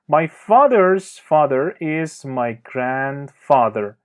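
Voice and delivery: very slow, careful teacher reading; slow clear example. Shown as a demonstration. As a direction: spell each word out slowly for learners. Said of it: "teacher reads example sentence". (جملهٔ نمونه را خیلی آهسته و شمرده بخوان)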